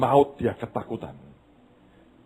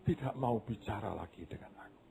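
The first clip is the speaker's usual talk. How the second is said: softer, falling in sorrow; tearful; 12 dB quieter than usual. Acted. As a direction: let the words fade in grief. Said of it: "Dia nangis".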